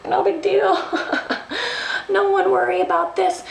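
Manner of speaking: high, giggly brush-off; retold breezy reassurance, laughing. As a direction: play it down with a nervous high laugh. Brushes off her embarrassing mishap.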